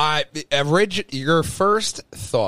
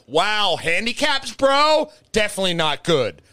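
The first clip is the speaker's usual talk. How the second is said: mock commenter scolding; 8 semitones higher, 4 dB louder than usual. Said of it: "somebody was like".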